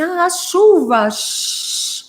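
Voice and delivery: high, slow "chuva" melting into shhh; rain sound effect. Retold or imitated. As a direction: playfully announce rain, then imitate its hiss. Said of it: "aí eu falei assim". (imitação brincalhona de chuva, aguda e alongada)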